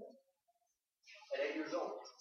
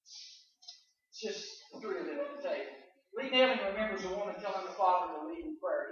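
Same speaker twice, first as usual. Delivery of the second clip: ordinary delivery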